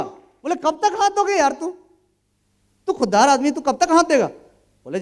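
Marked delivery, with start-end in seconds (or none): 0.5-4.3 retold senior's challenge. Senior challenging him about autos.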